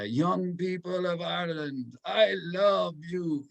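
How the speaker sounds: put-on Polish accent. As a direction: imitate the Polish Pope's accent with affection. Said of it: "that's my great Polish accent".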